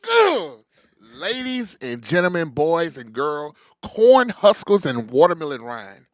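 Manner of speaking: loud, booming ring announcer; big announcer build-up; hype man intro. Acted. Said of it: "best hype man in America".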